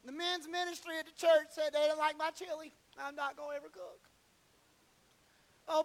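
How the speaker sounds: high, loud, whining complaint to the pastor; hammed-up sulky caller begging the pastor; whiny church member. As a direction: congregant whining to the pastor over petty slights, high and loud. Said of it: "He didn't call and say".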